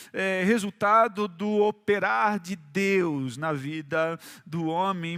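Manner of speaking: ordinary talk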